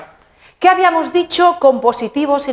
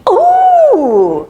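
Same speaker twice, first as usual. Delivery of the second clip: high, loud exclamation of alarm; a startled high "¡uh!" to warn; mock shocked "uh"; 13 semitones higher, 6 dB louder than usual. Shown as a demonstration. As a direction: yelp as if something is wrong. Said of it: "shocked gasp".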